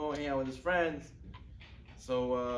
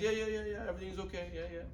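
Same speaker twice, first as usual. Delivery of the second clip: high rattled-off yeses, tailing off softer; mock dismissive agreement; 4 semitones higher, 4 dB quieter than usual. What we all say to parents, offhand brush-off. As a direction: placate a parent without listening. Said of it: "Like we all say to our parents".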